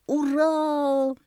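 high, long-held hurrah; cheering. A narrator's reading. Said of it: "кричал удав". (ликующе, высоко и протяжно)

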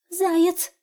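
high, loud, startled little hare; quick hare answer. A narrator's reading. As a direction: blurt it out fast like a frightened hare. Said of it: "быстро ответил тот".

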